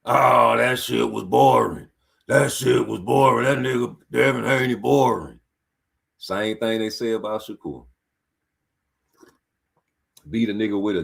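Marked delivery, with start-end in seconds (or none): 0.0-5.4 mocking fans. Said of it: "what they said about the fight?".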